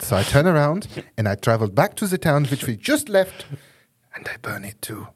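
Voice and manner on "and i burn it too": a sinister whispered aside; whispering. Pretend. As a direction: breathe it out under your breath.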